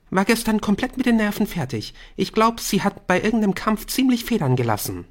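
put-on high chattering teen girl; gossipy schoolgirl voice; Bryn voice. A narrator's reading.

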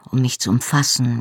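plain reading voice

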